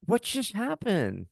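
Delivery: high, slow, stunned question; retold bewilderment. Couple at lunch after the diagnosis, dazed. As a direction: draw it out in high-pitched disbelief. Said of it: "looking at each other like".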